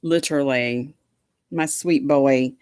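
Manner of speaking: ordinary talk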